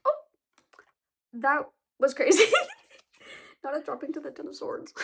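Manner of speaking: surprised laugh. Real, not acted. Card suddenly drops.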